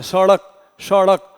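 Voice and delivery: सड़क repeated slowly with a clear flapped ड़; flapped ड़ drilled. Shown as a demonstration. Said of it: "समाज क्या बोलता है".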